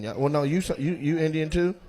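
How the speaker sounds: ordinary talk, nothing marked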